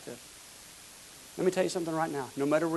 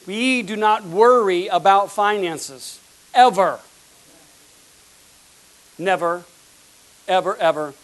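emphatic declaration; 3 semitones higher, 9 dB louder than usual. Real, not acted. Emphatic slow preaching.

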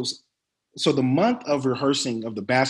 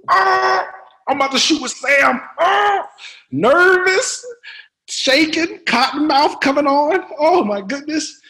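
loud, high mock panic; retold panic; 13 semitones higher, 7 dB louder, slower than usual. Actor retelling his shock at a scene with Sam, panicked. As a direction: re-enact sudden nerves at high pitch. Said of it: "my heart dropped".

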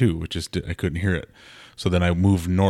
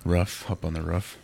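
"roof" said to rhyme with "rough", unhurried; slow Minnesotan "roof", short vowel like "ruff"; Minnesota accent; 6 dB quieter than usual. Shown as a demonstration. Asked to say roof, answers in Minnesota accent. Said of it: "say roof".

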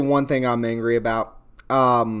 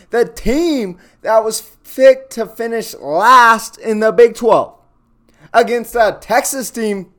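angry shout; 6 semitones higher, 4 dB louder than usual. Real, not acted. Ranting about the blown lead.

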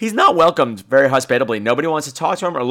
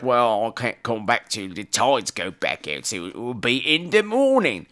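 put-on outrageous Hammer-villager accent; 4 semitones lower, 5 dB quieter than usual. Local warning the visitor about tides, outrageous villager accent. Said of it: "Why are you talking like that?".

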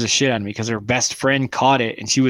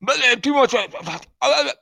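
much higher, slurring stammer; hammed-up drunken toff; mock drunk stammer; 9 semitones higher than usual. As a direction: stammer like a tipsy aristocrat.

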